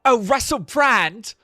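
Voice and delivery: high, loud, rambling British impression; Russell Brand. Streamer parodying a celebrity guru, high loud hammed-up impression. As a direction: push it up high, excitable English accent, gushing. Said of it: "Is it like Russell Brand".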